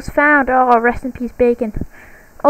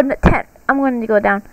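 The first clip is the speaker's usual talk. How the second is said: ordinary delivery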